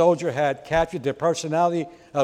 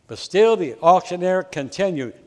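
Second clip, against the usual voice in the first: ordinary talk, nothing marked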